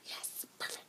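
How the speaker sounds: quiet private triumph; whispered glee. Retold or imitated. Narrator getting pastor's okay, whispered glee.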